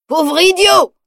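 high, loud contemptuous yell; angry yell. A narrator's reading. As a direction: scream the insult at him. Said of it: "hurle-t-il".

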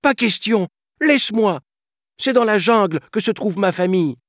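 very high, defiant yell; child yelling. A narrator's reading. Boy refusing to leave the jungle, yelling. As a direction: protest fast and high like an upset boy. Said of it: "hurla Mowgli".

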